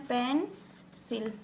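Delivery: "Pencil" slowly broken as पेन, pause, सिल; "pencil" as two slow, separate syllables; split into syllables. Shown as a demonstration. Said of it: "इसको अलग-अलग हम करते हैं".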